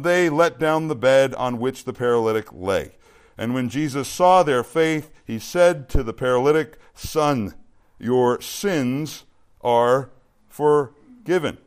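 loud, deliberate Bible reading, slowing on the quote; scripture reading. Acted. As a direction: proclaim the passage word by word. Reads scripture aloud to congregation.

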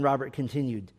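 usual speaking voice

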